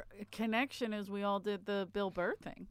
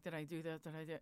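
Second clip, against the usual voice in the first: much softer, muttered to self; quiet inner worry; 4 semitones lower, 12 dB quieter, faster than usual. Retold or imitated. Comic replaying his set in his head, soft worried muttering. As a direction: fret under your breath.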